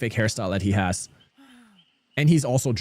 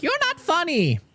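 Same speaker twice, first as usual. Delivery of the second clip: mocking high voice. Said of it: "People like".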